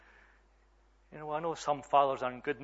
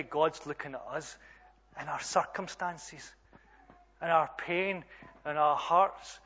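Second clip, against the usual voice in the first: ordinary delivery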